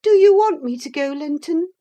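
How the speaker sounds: high, quick pleading; high girl pleading; anxious girl. A narrator's reading. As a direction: hurry to ask. Cathy anxious.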